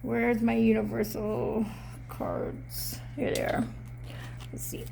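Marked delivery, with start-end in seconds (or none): 2.1-4.8 whispered aside. Real, not acted. Searching for cards off-mic.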